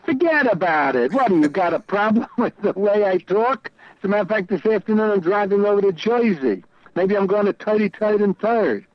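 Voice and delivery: mock New York tough guy with a laugh; thick New York accent. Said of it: "Oh, he sounds so New York".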